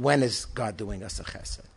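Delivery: ordinary talk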